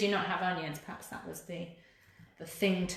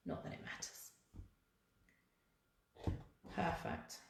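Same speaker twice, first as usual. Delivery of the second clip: muttered to self; 15 dB quieter than usual. Real, not acted. Muttering to herself while threading.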